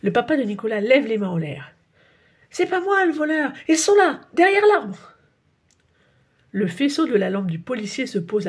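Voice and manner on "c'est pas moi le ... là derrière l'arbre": much higher, louder, frantic; panicked protest. A narrator's reading. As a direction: point the police away in a panic. Father panics before police.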